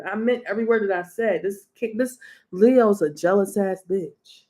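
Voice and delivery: normal talking voice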